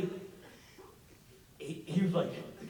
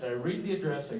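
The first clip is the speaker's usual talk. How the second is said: ordinary talk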